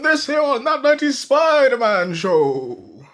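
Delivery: high, hollow ghostly Santa; a dead Santa's booming ho ho ho. Acted. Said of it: "I was just doing an impression of Dead Santa".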